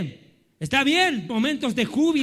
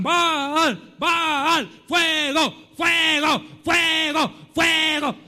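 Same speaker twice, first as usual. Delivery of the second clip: repeated raised cries, each word stretched; mock frenzied shouting; 3 semitones higher, much slower than usual. Preacher imitating Baal's prophets calling for fire, mock shouting. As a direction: chant it like a frantic worshipper, mockingly.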